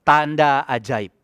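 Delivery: slow, deliberate declaration of the phrase. Shown as a demonstration. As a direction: lay out "Tanda ajaib" word by word, slowly. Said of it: "berkata satu hal ini". (ucapkan "Tanda ajaib" dengan pelan dan mantap)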